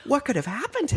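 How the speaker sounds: high-pitched hammed-up bafflement; exaggerated clueless rescuer, pitched high; mock confused. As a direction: wonder aloud in mock bewilderment. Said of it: "they're so confused".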